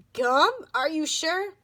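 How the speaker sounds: loud incredulous question; surprised. A narrator's reading. Surprised reply.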